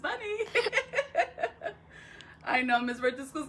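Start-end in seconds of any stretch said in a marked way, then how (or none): 0.4-1.7 high giggle at her own joke; high laugh. Real, not acted. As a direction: laugh brightly at your own punchline.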